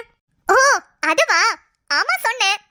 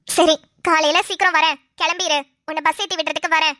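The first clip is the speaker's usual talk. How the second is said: lower, urgent friend pressing her advice; a lower-voiced scheming friend urging the crying wife; scheming friend Roja; 8 semitones lower than usual. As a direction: push her to go home now, low and insistent. Roja plans morning.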